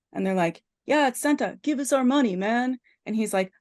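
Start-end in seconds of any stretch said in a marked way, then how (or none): 0.9-2.8 higher-pitched retold protest; insistent demand. Hunters arguing with the buyer over their money, mock insistent demand. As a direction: push back as an impatient hunter, lightly acted.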